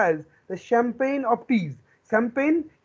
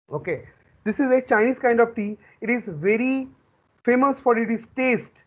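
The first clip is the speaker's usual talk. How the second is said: ordinary talk, nothing marked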